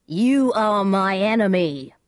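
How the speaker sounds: slow, hostile narrated shout. A narrator's reading. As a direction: call out an accusation slowly and forcefully. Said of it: "Tybalt began to shout at him".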